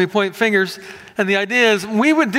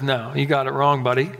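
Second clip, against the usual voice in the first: lower, casually correcting God voice; mock God; 6 semitones lower than usual. God corrects Abraham, lower, casual mock rebuke. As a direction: gently set Abraham straight, lower. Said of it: "And God says".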